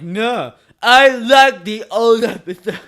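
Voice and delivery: put-on loud, slow goofy accent impersonating Vinny; silly accent. Podcaster mocks cohost Vinny refusing to re-record, in a loud goofy accent. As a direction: put on a nonsense accent, laughing through it. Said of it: "I don't know what accent I was doing there".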